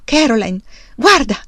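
loud, rising in pitch on the second word; excited calling. A narrator's reading. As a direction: call her over, thrilled. Excited man calls wife to look.

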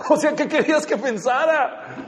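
high-pitched, mock-exasperated question; exasperated retort. Partner who was never told answers back, exasperated. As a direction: protest with a laugh in the voice.

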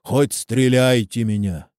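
ordinary delivery

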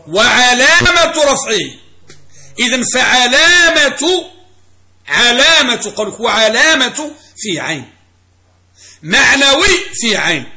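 علامة stretched out slow and high; emphatic teaching stress. Shown as a demonstration. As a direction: drive home علامة and معنوي, loud and drawn out. Teacher hammers home the key word. (تشديد ومدّ على «علامة» و«معنوي»)

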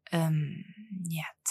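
low, soft, reluctant answer; unsure, dropping very quiet; hesitant drawl. A narrator's reading. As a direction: hum doubtfully and let the word fade away. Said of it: "протянул мужчина".

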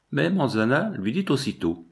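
ordinary delivery, nothing marked

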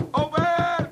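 high, loud shout across the room; calling out. Acted. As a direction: bellow for service. Calling the barman loudly.

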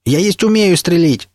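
high, slow outburst; crying out. A narrator's reading. William objects that he can shoot, crying out. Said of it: "выкрикнул Уильям Блейк".

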